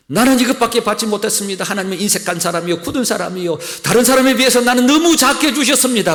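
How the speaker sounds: loud, high, resentful grievance to God; rising whiny complaint that swells into a loud protest; complaining grumble. Acted. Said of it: "원망하고 불평하고".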